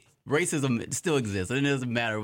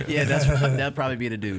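laughing; 3 semitones higher, 5 dB louder than usual. Real, not acted.

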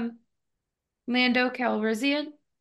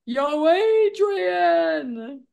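very slow, stretched-out higher call; hammed-up boxer wailing for his wife; Rocky's call; 6 semitones higher, much slower than usual. Co-host imitating the boxer calling his wife, drawn-out wail. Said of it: "the only thing he can say is".

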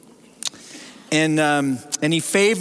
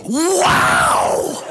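high breathy gasped Wow!, much higher and louder; amazed little girl; 8 semitones higher, 4 dB louder than usual. Retold or imitated. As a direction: imitate a little girl blown away, gasping Wow!. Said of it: "this little girl goes".

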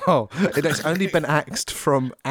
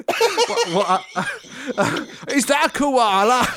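put-on high, laughing Australian accent; mock Australian; 12 semitones higher, slower than usual. Friend in a Neighbours parody, a high laughing Australian accent. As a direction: play a giggly Aussie spotting a koala. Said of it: "To sound very Australian".

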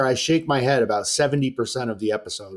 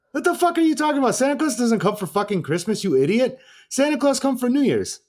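much higher and faster, indignant mock rant; mock outraged foreigner; 11 semitones higher than usual. Host mimicking Florian scolding the producers about Santa, high indignant rant. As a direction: squeal it like a guy outraged at a silly question.

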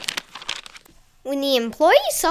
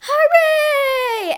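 cheering hooray; 14 semitones higher, 6 dB louder than usual. Acted. Crowd celebrating.

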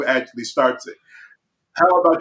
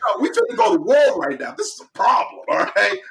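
higher, riled-up protest; hyped-up husband ready to argue; worked-up self; 8 semitones higher, faster than usual. Retold or imitated. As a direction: sound ready to go to war. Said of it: "I'm like".

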